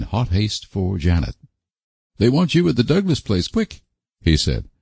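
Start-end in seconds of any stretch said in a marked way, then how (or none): 2.2-3.8 urgent farmer voice. A narrator's reading. Alec urgent message.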